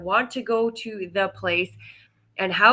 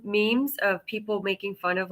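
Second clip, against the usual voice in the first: usual speaking voice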